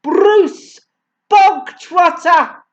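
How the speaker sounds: high, loud barked name; sudden bark. A narrator's reading. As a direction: bark the name out like a drill sergeant. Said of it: "the Trunchbull barked suddenly".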